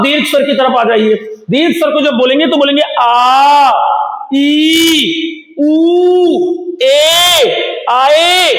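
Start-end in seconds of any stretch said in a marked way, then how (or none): 3.0-8.5 each vowel held long and raised; drawn-out long vowels. Shown as a demonstration. Teacher to pupils, drawing each long vowel out. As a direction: stretch every vowel out for the class. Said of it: "ऐसे, मतलब थोड़ा सा ज्यादा समय लगता है".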